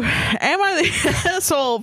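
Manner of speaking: slow read broken by laughter; laughing. Shown as a demonstration. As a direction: try to read it but keep cracking up. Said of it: "Try take two".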